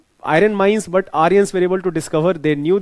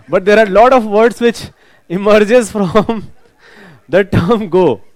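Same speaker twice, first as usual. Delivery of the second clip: slow and louder, laughter bubbling through; laughing; 3 semitones higher, 6 dB louder, slower than usual. Real, not acted. Teacher steering back to the lesson while still laughing. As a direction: keep laughing as you get back on track.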